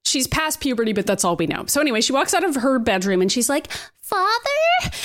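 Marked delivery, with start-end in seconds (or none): 4.0-4.9 high, timid call to her father; a young girl calling out for her dad. Acted. Young Polaris calls her father.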